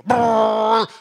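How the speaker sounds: loud, high brrr of a toy truck engine; truck engine noise. Retold or imitated. Preacher imitates a boy playing with his truck, with a loud brrr. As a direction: growl it out like a kid pushing a truck.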